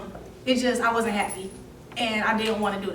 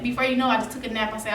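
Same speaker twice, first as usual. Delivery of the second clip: ordinary delivery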